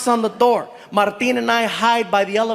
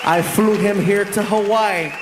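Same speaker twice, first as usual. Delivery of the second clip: announcer; 6 semitones lower than usual. Acted. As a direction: introduce the guest like a host. MC introducing guest.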